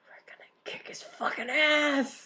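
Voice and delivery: unvoiced conspiratorial whisper. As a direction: whisper the plot like a schemer. Whispered plotting by allies.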